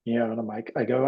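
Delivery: ordinary talk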